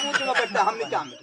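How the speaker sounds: muttered aside. Acted. Brother mutters complaint aside.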